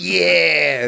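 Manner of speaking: acting out giddy excitement; excited cheer. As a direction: whoop it out with over-the-top eagerness. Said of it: "I'm always so excited".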